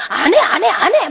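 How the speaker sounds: high, loud mimicked refusals; mock someone stubbornly refusing; petulant refusal. Church member asked to serve, high stubborn refusal.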